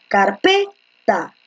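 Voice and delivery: "carpeta" slowly, car-PE, then ta; stress on middle syllable. Shown as a demonstration. Teacher testing where stress falls, "carpeta" slowly stressed on PE. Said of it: "la fuerza de voz está en P".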